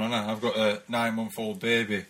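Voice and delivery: usual speaking voice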